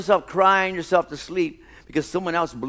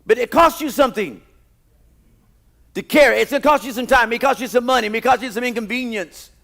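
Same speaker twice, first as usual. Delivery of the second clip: loud, high, driving sermon delivery; full-force preaching; 10 semitones higher, 10 dB louder than usual. Acted. Pastor preaching to his church, loud and high. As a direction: preach it hard to the congregation.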